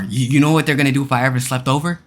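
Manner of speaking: ordinary talk, nothing marked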